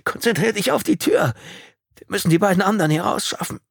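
pressing, strained urging; urgent command. A narrator's reading. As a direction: snap a shocked friend back to the task. Urging shocked friend to the door.

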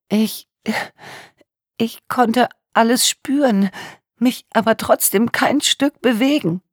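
high, slow, trembling victim voice; tearful young woman. A narrator's reading. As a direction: voice a traumatised young woman in tears.

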